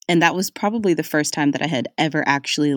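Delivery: ordinary delivery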